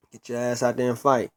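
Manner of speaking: normal talking voice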